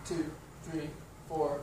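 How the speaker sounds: softly counting the beats, leaning on one; quiet counting. Shown as a demonstration. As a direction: count under your breath, stressing one. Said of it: "accents on beat one".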